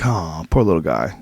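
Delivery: low, soft sympathy; a small tender lament, understated; mild pity. Retold or imitated. Owner on a chicken that died, mildly sorry. Retells reaction to chickens dying.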